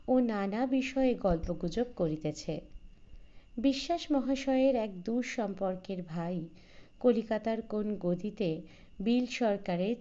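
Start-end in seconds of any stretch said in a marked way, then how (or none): none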